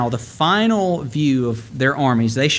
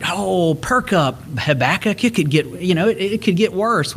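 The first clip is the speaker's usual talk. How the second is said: mock cheerful; 5 semitones higher than usual.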